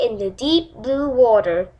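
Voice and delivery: ordinary delivery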